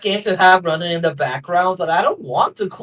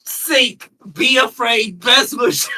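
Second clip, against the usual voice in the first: ordinary delivery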